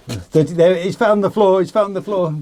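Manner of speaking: ordinary talk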